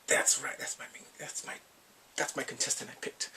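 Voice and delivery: hushed, near-whispered boast; proud aside. Retold or imitated. Viewer voices him proudly claiming his contestant, hushed. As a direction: say it under your breath.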